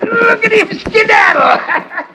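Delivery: high, slow, laughing jeer; laughing shout. Acted. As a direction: crow with laughter as he runs. Mocking the fleeing rival.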